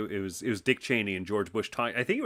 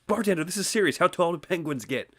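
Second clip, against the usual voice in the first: high-pitched pleading insistence; hammed-up urgency, pitched high; urgently serious; 8 semitones higher than usual. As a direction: act a desperate man demanding an answer. Guy insists to chuckling bartender.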